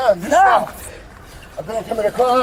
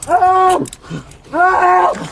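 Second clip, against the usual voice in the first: high, loud cries for help; crying for help; 8 semitones higher, 5 dB louder than usual. Real, not acted. Pinned man crying out to bystanders, loud desperate yell. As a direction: scream for help as if in danger.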